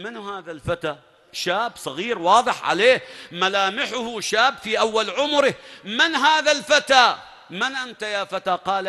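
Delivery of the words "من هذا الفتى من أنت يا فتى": mocking challenge. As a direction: sneer down at the young man, drawing it out. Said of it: "يسأل". (سؤال ساخر بصوت أعلى وأبطأ)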